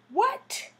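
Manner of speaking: high, sharp shocked "What?". Retold or imitated. Hearing her nephew is dead, a high shocked what. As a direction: jolt up in disbelief on one word. Shocked reaction to death news.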